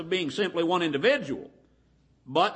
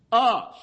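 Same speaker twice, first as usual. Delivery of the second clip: "us" punched higher and louder; stress on US; 4 semitones higher, 6 dB louder than usual. Shown as a demonstration. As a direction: hit "us" hard to show plurality. Quoting "Let us make man.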